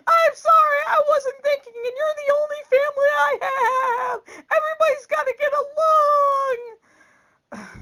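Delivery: high, loud mock wailing; exaggerated tearful squeal, dragging out the last word; imitated crying. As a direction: bawl the apology like a hammed-up sobbing daughter. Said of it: "And she's crying harder now".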